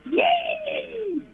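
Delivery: very high squeal with laugh; cheering. Acted. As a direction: squeal it with delight. Caller cheering.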